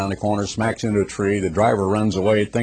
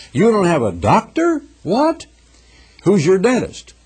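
mock disbelief; 9 semitones higher than usual. Busybody shocked the man has no doctor, mock disbelief.

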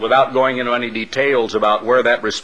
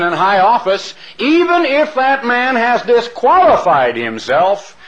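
quoting a preacher; 6 semitones higher than usual. Retold or imitated. Minister urging a church to stay loyal, raised preaching voice. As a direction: preach it like the quoted minister laying down the rule.

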